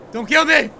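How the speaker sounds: alarmed plea. Real, not acted. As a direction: cry out to be spared. Repeats plea louder to game.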